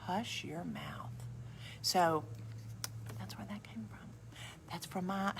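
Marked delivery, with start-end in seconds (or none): 0.1-1.1 slow whispered scold; mother's hush. Retold or imitated. Mother scolds her child: slow whispered hush. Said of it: "and she would go".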